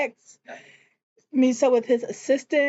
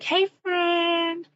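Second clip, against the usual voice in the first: lightly voiced friend, sung out higher; a hint of a bubbly friend calling hey; chirpy friend greeting; 9 semitones higher than usual. Friend pulling up in her car greets Nandy, higher and chirpy.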